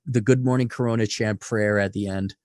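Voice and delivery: normal talking voice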